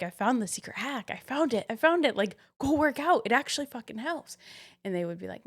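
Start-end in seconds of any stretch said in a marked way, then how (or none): 0.0-4.4 higher, building excited pitch to friends; excited urging. Retold or imitated. Telling struggling friends about a secret hack, excited urging.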